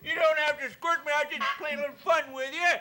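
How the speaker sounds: high, bright cartoon puppet voice, pushed loud; high puppet voice scolding Clarabelle the clown. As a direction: play a squeaky kids' show puppet teasing the clown. Howdy-show puppet banter.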